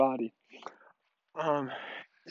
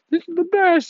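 much higher, gleeful mock cheer; retold delight; 3 dB louder than usual. Family enjoying the rope swing together, retold delight. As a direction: imitate the family's thrilled cry.

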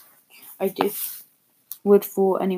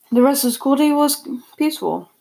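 usual speaking voice

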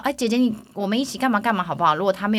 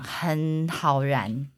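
very slow, softer, drawn out; slow emphatic; 4 semitones lower, 5 dB quieter, much slower than usual. Real, not acted. Telling friends his temper ignites easily, very slowly. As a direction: savor each word. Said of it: "我跟你讲".

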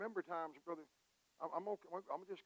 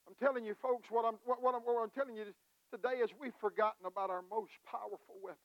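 preach; 5 semitones higher, 4 dB louder than usual. Acted. Preacher rises to full force.

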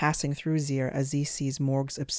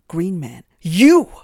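high, loud gasp of disbelief; shocked; 4 semitones higher, 15 dB louder, slower than usual. A narrator's reading. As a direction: blurt it out in shock. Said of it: "he gapes at Shalot".

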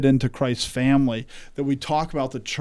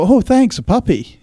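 much higher, louder mock gratitude; sarcastic thanks; 9 semitones higher, 10 dB louder than usual. Mocking how people thank God for a puppy, sarcastic. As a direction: fake delight at a consolation prize. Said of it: "we go".

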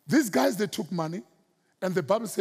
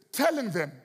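normal talking voice

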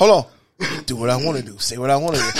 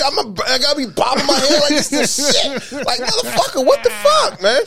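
laughing through the words, higher; can't stop laughing while talking; laughing disbelief, pitched up; 9 semitones higher, 3 dB louder than usual. Real, not acted. Laughing at bobbing head.

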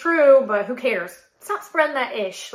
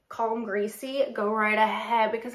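ordinary delivery

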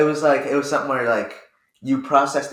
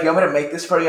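higher, louder mom voice; imitating mom; 7 semitones higher, 5 dB louder, much faster than usual. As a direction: lightly mimic a pushy mom. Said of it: "My mom's like".

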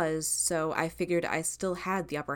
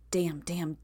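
whispered curse. Acted. Narrator curses under her breath.

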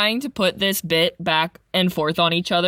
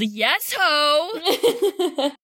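laughing cheer; 9 semitones higher than usual. A narrator's reading. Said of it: "our girls yelled".

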